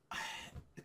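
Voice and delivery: soft, breathed-out sigh. Real, not acted. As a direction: let out a quiet sigh before going on. Reviewer sighs before his own verdict.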